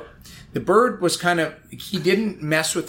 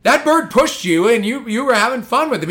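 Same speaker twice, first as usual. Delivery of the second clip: higher, louder mock scolding; imitating angry coach; 7 semitones higher, 5 dB louder than usual. Voicing angry coach Smitty.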